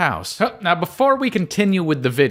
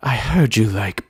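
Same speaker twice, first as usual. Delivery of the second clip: evil sister impression; 7 semitones lower than usual. Shown as a demonstration. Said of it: "do it in your best evil sister impression voice".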